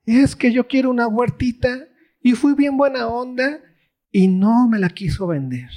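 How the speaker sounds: put-on high pouting complaint like a child; king whining to his wife about the garden; whiny Ahab. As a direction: whine it like a kid denied a toy. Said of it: "¿qué le dice Acab a Jezabel?".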